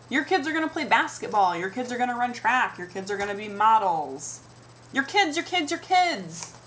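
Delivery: mock-excited bystander rattling off predictions; gushing strangers. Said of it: "new comments just thrown at me".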